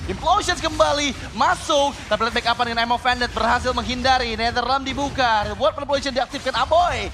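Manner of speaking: loud rapid fight calling; hype play-by-play. Real, not acted. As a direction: call every move loudly. Play-by-play of second teamfight. (komentator pertarungan yang heboh)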